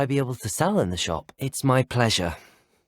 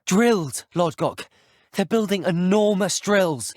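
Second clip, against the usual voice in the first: raised, louder voice of astonishment; shocked outburst, pitched up; alarmed exclamation; 7 semitones higher, 4 dB louder, slower than usual. Acted. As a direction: exclaim it high, in alarm at the discovery. Character exclaims over an alarming discovery.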